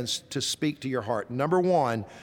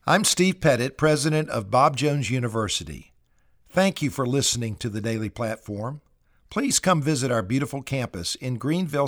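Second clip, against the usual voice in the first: lower-pitched, measured radio outro read; announcer sign-off; 5 semitones lower than usual. Acted. University president thanks listeners of the programme, announcer sign-off.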